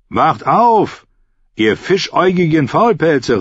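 hoher, kräftiger Weckruf; rufend. A narrator's reading. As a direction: ruf die schlafende Mannschaft wach. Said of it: "rief er". (lautes, hohes Rufen)

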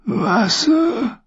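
high, rasping plea of a parched prisoner; a high broken croak begging for water. A narrator's reading. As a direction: croak it out, throat dry as dust. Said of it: "krächzte er".

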